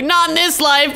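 put-on reading a girl's chat refusal, high and loud; mock refusal. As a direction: brush him off with sass. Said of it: "She's like".

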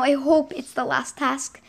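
ordinary talk

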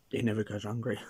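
words muffled by food at lips; talking mid-bite, mouth full; speaking while biting food. Real, not acted. Seen 3: Pizza slice held at lips and bitten into during the words.

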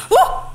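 sudden high startled yelp. Real, not acted. Player jumps at a game scare, high startled yelp. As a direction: jolt out a frightened yelp.

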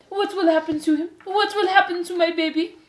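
high, loud melodramatic pleading; distraught mother. A narrator's reading. Antoinette fearing for her baby, distraught. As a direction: wail the questions in a high voice. Mother begging the hooded mice.

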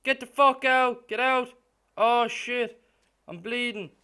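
loud, high panicked shouts; panicked yelling. Real, not acted. Gamer attacked and bleeding, panicked yelling. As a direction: yell in panic.

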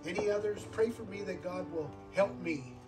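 usual speaking voice

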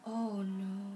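plain reading voice

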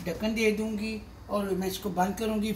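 ordinary talk

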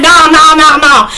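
loud, high rapid-fire refusals; hammed-up horrified protest; screaming at TV. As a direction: yell repeated refusals at the screen. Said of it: "screaming at the T V".

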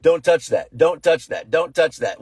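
insistent, half-strength scolding; mock yell. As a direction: act out a father ordering a child off. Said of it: "you could yell at him".

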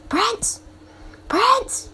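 high calling shout after a pet. Real, not acted. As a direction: call the runaway dragon. Calling the runaway pet dragon.

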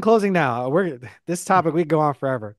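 usual speaking voice

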